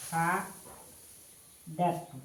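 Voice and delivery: very slow, with a gap inside the word; slow dictation. Shown as a demonstration. Teacher writing the verb out for students, slow dictation. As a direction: draw the word out in separate syllables.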